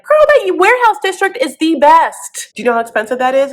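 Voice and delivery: high, loud, gushing mock saleswoman; pushy sales lady. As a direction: play an overexcited shop assistant.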